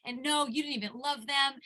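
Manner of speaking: high, loud retold accusation; mock angry outburst; retold screaming. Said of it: "they were screaming".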